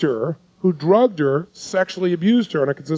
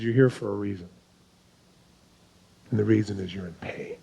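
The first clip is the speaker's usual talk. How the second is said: quiet and intense; 9 semitones lower, 8 dB quieter than usual. Shown as a demonstration. Coach drops his voice low to make her feel her pain. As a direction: drop the voice low to make it land. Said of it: "No, it's quiet".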